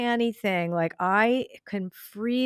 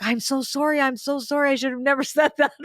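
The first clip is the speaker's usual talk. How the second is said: much higher, fast, frantic apologizing with a laugh; mock panicked apology, pitched way up; flustered apology; 7 semitones higher, 3 dB louder, faster than usual. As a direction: scramble to take it back. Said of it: "freaked out completely".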